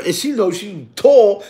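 ordinary talk, nothing marked

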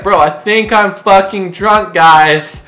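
put-on slow, high, loud drunk announcement; drunken yelling. Drunk guy announcing it to friends, yelling. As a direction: bellow it slowly, oblivious to the room. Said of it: "stop yelling".

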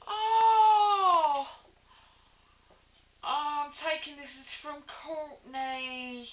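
delighted surprise. Real, not acted. Vlogger opening a gift from Courtney, squealing with surprise. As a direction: gasp with joy at an unexpected card.

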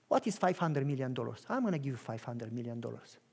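low soft offhand generosity; casual quiet offer. Acted. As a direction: say the huge sum casually. Rich friend offers gift softly.

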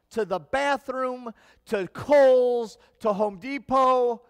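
fervent pulpit delivery; preaching. Acted. Pastor preaching to congregation.

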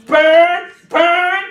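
loud shouted deliverance prayer, commanding spirits out; preaching at full force. Acted. Preacher commanding spirits out of the women at the altar, shouting. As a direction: cast the demons out, full voice.

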